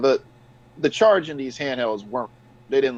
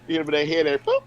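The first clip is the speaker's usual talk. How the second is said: ordinary talk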